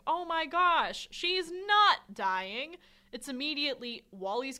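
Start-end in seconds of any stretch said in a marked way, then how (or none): 0.1-2.8 loud, drawn-out mock rejoicing; hammed-up celebratory exclamation; mock celebration. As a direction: exclaim it slowly, high and loud, as imagined relief. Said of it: "there's no moment of celebration".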